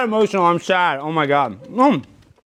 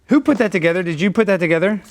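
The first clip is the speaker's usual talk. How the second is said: ordinary talk, nothing marked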